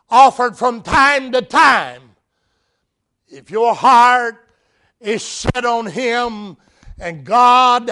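rising preacher's call, slow and loud on the key lines; preaching at full force. Acted. Preacher proclaiming to the church, loud and building.

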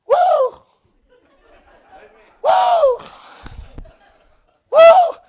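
very high, loud shouts of joy; joyful shouts. Retold or imitated. Preacher imitating the old mother whooping in church, high and loud. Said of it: "she was just going scuffing around saying".

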